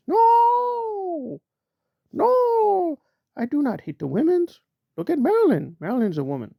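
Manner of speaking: high, pleading mock voice; hammed-up falsetto plea; mock whiny Aaron. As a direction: squeal it out like a whiny man hiding behind a woman.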